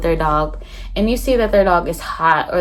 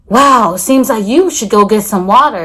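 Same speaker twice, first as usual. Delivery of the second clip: loud, fake-concerned call to a jogger; mock loud concern; 3 semitones higher, 9 dB louder than usual. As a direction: mimic a nosy stranger, loud and preachy. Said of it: "you wanna stop them and say".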